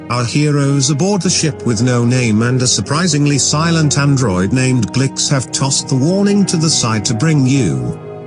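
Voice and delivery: ordinary delivery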